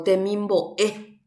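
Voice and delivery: temimbo'e with a strong last E; stress on final E. Shown as a demonstration. Teacher showing an unwritten accent, stress on final E. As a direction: punch the closing E in temimbo'e. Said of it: "E ári jajepa hatãve ja'e".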